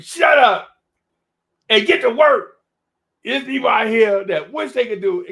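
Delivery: high, loud scolding rant; mock scolding. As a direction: tear into a lazy nephew at full volume. Scolding a lazy young rapper.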